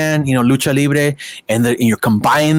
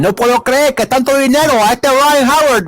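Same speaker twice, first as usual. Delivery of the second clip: much higher Spanish broadcaster voice, amazed; 10 semitones higher than usual. Retold or imitated. Guest imitates the Spanish broadcaster marveling at the contract, much higher voice. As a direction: mimic the old announcer marveling in Spanish.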